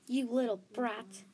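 loud, acted insult; angry yell. A narrator's reading. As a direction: hurl it at her in anger. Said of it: "Snow yelled".

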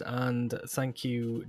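posh voice. Acted. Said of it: "listening to my posh voice again".

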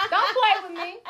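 high laughing sassy auntie voice; Madea voice. Retold or imitated. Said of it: "Just like Madea did".

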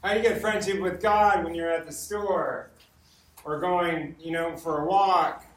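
ordinary delivery

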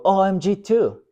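high, chirpy exclamation; mock giddy echo. Pastor plays churchgoers echoing casual blasphemy, high and giddy. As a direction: playfully act giddy agreement.

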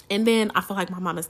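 normal talking voice

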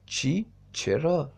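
sudden high-pitched shocked cry; shocked question. A narrator's reading. (پرسش ناباورانه و شوکه با صدای زیر)

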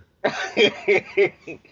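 high burst of laughter; loud laugh. Real, not acted. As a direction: crack up loudly. Big laugh after answer.

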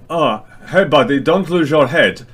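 lightly ape a jovial player dropping a foe; imitating Pavel. Teller lightly apes Pavel's cheery voice to the unconscious guy. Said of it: "I will ape his voice a little bit".

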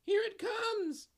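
high, slow, loud cry. A narrator's reading. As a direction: call it out from far off. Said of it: "a voice cries".

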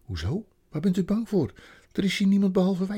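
put-on anxious child. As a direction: press the question urgently. Child asks beaver anxiously.

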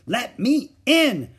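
put-on high, loud, slow child's scream. A narrator's reading. Little sister screaming to be let in the bathroom. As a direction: scream it through the door like a frantic little girl. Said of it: "screamed Ramona from the hall".

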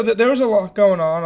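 normal talking voice

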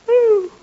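big open-mouthed yawn; loud yawn. Acted. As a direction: let out a noisy yawn. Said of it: "cover your mouth when you yawn".

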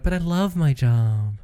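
hammed-up whiny objection; mocking imitation. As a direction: mimic the co-host's protest slowly. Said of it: "you're like".

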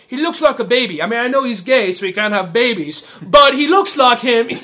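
mock the baby photo in a put-on British accent. Host voicing the Irish character's cheeky line in a British accent. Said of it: "I'm doing a British voice for an Irish character".

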